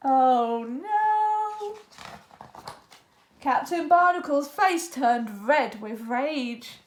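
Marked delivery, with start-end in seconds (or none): 0.1-1.8 gasp. A narrator's reading. Reader gasps at trouble.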